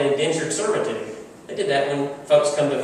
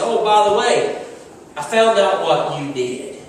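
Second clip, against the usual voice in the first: higher, louder menacing confrontation; angry king; 6 semitones higher, 7 dB louder, slower than usual. Acted. King confronting the wicked servant, loud and menacing. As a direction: confront him with cold fury. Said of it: "The king was angry".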